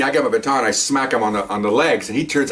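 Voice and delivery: plain reading voice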